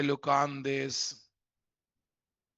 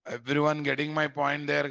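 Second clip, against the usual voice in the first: ordinary talk, nothing marked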